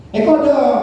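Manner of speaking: put-on high, loud regional-accent exclamation; regional Indonesian accent. Coach mocking an MC's slip, regional accent. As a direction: blurt it in a thick regional accent. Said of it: "membawa logat daerah kita".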